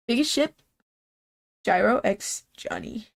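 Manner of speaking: normal talking voice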